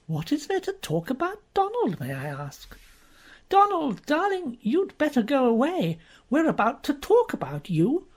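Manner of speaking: high, cooing contempt; condescending woman. Acted. Uziette patronising jealous Jean, high and cooing. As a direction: purr it down at her.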